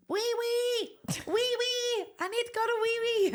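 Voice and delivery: put-on high, small child's voice calling in the night; toddler voice. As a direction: squeak it like a sleepy toddler. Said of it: "you're waking up to him".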